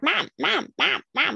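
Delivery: high repeated calls of mom; kids nagging. Retold or imitated. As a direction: mimic children pestering. Kids calling her all morning.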